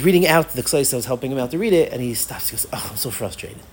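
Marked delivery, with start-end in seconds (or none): 2.7-3.6 low, quiet sigh of frustration; frustrated student. Retold or imitated. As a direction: imitate a dejected student giving up. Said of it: "Why are you frustrated?".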